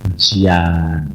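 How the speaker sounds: 1 God's pity. Retold or imitated. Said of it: "Tuhan ini bilang apa?".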